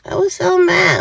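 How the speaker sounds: usual speaking voice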